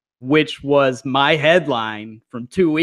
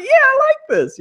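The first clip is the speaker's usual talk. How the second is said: squeaky, much higher delighted voice; 4 dB louder than usual. Retold or imitated. Host enjoying the scene, much higher squeak. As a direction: jump way up into a squeak. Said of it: "my voice went so high there".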